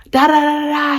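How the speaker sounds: high mock-rant syllables; mock going off. Teller mimics her rival going off, mocking. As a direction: babble an imitation of someone ranting. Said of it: "She was just going off".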